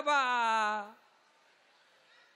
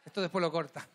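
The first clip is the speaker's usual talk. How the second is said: ordinary talk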